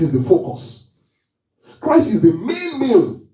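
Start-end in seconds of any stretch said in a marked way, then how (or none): 1.8-3.2 soaring preacher cry, high and loud; exclaimed high. Real, not acted. Preacher to congregation, a high slow proclaiming cry. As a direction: lift it up and proclaim it slowly.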